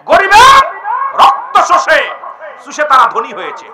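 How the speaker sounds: high, loud shout to the crowd; ringing denunciation; outraged preaching cry. Acted. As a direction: hurl each word out separately. Preacher cries out against exploiters.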